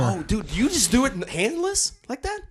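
amazed. Real, not acted. Amazed by a handless snot rocket.

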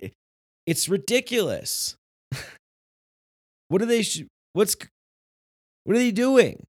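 normal talking voice

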